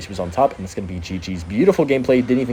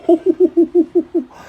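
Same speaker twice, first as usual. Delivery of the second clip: high, loud, pulsing hoot of glee; excited hooting laugh; 6 dB louder than usual. Real, not acted. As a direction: let out a high, delighted hoot. Celebrates own play.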